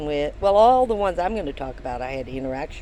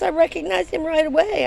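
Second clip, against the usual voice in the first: higher, louder gushing boast; excited friend; 11 semitones higher, 7 dB louder than usual. Retold or imitated. Friend excitedly boasting she recognized him at once. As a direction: still thrilled, bragging.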